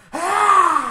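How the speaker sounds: loud high scream; mock scream. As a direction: shriek to stop everyone.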